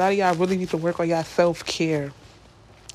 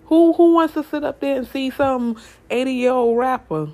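louder and higher, scoffing at the idea; incredulous rant; 6 semitones higher, 6 dB louder than usual. Real, not acted. Ranting to viewers about rappers who turn preacher, incredulous and loud.

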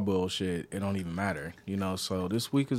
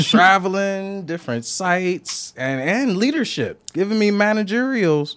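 exasperated; 8 semitones higher, 4 dB louder than usual. Real, not acted. Complaining about work duties.

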